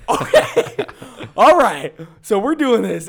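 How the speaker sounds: high, loud, laughing reaction; laughing disbelief. Retold or imitated. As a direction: act rattled through a laugh. Acts out reaction to intense man.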